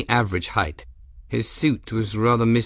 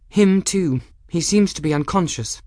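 put-on young woman Gwen; 9 semitones higher than usual. A narrator's reading. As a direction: call it out briskly as Gwen. Gwen voiced by narrator.

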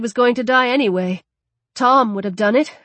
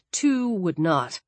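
ordinary delivery

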